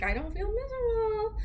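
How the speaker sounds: high, whiny protest; mock whiny protest. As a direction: whine it like a denial. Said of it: "And I'm like".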